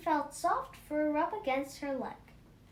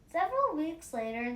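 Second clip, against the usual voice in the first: usual speaking voice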